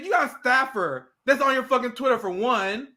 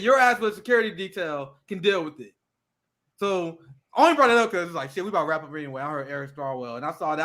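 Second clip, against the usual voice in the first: normal talking voice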